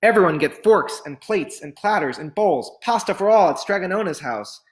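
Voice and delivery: calling out boastfully to the square; proud shout. Acted. Big Anthony calling the town from the fountain, shouting. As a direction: announce it like a town crier. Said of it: "and shouted".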